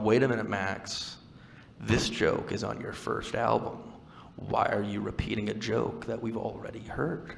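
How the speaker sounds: lightly voiced puzzled heckler, drawn out; mock audience objection. Comic voices the audience objecting to an old joke, mock complaint.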